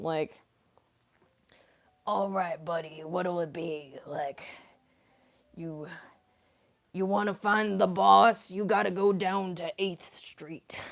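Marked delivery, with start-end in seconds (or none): 2.1-10.8 put-on Boston dude voice; Boston accent. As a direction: play a Boston guy talking to his buddy. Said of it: "a Boston accent".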